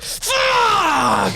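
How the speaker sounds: very high gamer scream; reenacted rage shout. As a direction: scream the curse as an acted game rage. Reenacts raging at a game.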